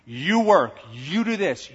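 ordinary talk, nothing marked

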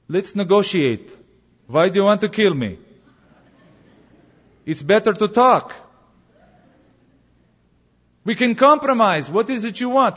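soothing Russian voice. Acted. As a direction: sweet-talk the hunter like a smooth Russian bear. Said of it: "in a very soothing Russian voice".

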